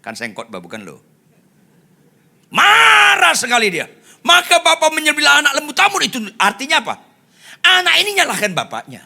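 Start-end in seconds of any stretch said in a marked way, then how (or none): 2.5-7.0 high, shouted pulpit outburst; full-force preaching. Real, not acted. Preacher explaining son's anger, shouting. 7.7-9.0 high, loud and fast declaration; quick forceful cry. Real, not acted. Preacher making his point, quick and loud. First take of repeated point.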